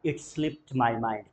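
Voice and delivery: "It slipped my mind" said very slowly, word by word; slow model phrase. Shown as a demonstration. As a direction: drag out "It slipped my mind" for learners. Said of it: "क्या हो जाएगा?". (धीरे-धीरे "It slipped my mind")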